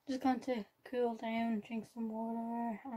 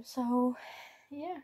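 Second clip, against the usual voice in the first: usual speaking voice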